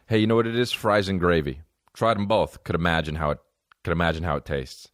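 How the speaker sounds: ordinary delivery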